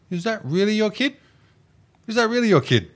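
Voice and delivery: high, sneering put-on voice, asked twice; mocking taunt. Ex-boyfriend taunting her about the kid, high mocking voice.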